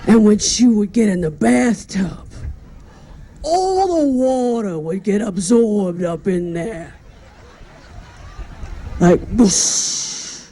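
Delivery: slow, halting, tearful eulogy voice with catches between words; Howard Stern choking back tears. Retold or imitated. Howard Stern at Joan Rivers' funeral, choking back tears. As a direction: do a low, choked-up Howard Stern fighting back sobs. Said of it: "he's choking back tears".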